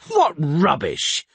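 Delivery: loud, slow indignant yell. A narrator's reading. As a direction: bellow it out in outrage. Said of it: "yelled Foltest".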